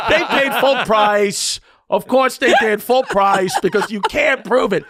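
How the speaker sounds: loud, high, emphatic gloating; triumphant declaration. Retold or imitated. Funeral director boasting nobody can prove it, triumphant. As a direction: crow it like the man being imitated.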